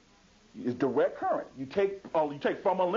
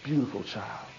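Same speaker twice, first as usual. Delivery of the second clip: put-on praising a child; 7 semitones lower than usual. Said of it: "feed the mind of one of them saying".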